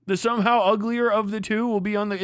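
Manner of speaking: ordinary talk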